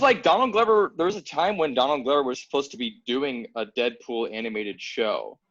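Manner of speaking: usual speaking voice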